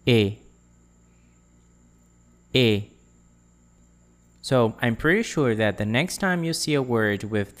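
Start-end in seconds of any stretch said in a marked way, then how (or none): 0.1-0.4 E said as a French closed "ay" without glide. Shown as a demonstration. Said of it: "going to pronounce it like". 2.5-2.8 E said as a French closed "ay" without glide. Shown as a demonstration. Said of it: "going to pronounce it like".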